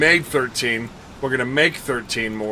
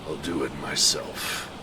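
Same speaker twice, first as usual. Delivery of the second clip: deep mock grumble, much lower; grumbling low voice; 11 semitones lower, 8 dB quieter than usual. Gamer grumbling about chores in a deep sulky voice. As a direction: sulk it out in a low growl.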